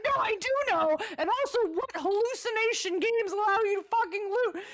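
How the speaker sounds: high, loud disbelieving shout; exasperated yell. Retold or imitated. Gamer yelling at her partner for looting. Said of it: "And I'm like".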